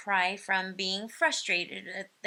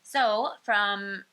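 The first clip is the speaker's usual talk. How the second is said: ordinary talk, nothing marked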